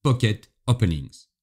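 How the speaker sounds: English accent, read out carefully; accent anglais. Shown as a demonstration. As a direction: put on your best English accent. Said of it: "tenez-vous bien avec mon accent anglais". (avec l'accent anglais)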